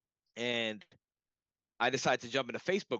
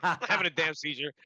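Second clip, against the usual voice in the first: laughing protest; 5 semitones higher, 4 dB louder, faster than usual. Retold or imitated. Laughing retort to seizure suggestion.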